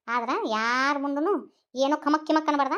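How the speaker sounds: ordinary talk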